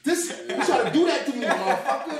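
loud, high laughing mock outrage; laughing rant. Telling the host about the psych ward, loud laughing rant. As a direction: rant while cracking up.

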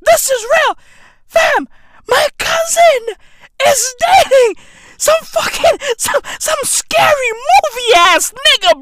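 high-pitched, breaking up with laughter; words squeezed out between laughs; laughing while talking. Real, not acted. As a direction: push the words out through real laughter. Cracking up at his cousin's boyfriend.

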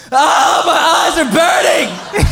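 high, loud, hammed-up cry of burning eyes; comic shout of stinging pain. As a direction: yelp it like the smog just hit you. Eyes burning from smog in India.

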